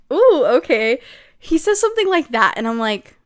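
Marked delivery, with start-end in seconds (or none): 0.1-1.0 loud, swooping ooh; mock intrigued reaction. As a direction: react loudly with playful intrigue. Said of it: "Every time I'm like".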